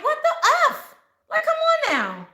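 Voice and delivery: high, fed-up what-the-heck; exasperated outburst. Acted. To someone who won't listen, a high exasperated outburst.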